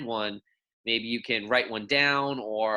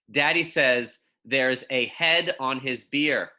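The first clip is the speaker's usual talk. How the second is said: put-on clear, measured picture-book reading for young kids; reading to children; 3 semitones higher, slower than usual. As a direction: read it gently to second graders, savouring each pun. Reads picture book aloud.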